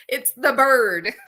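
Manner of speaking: real amused laugh. Real, not acted. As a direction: laugh out the punchline. Laughing at the funny story.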